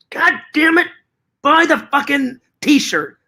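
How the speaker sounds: high, strained angry outburst; hammed-up exasperated demand; mock fury. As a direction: explode at a stubborn customer, jokingly. Imagined threat to a customer.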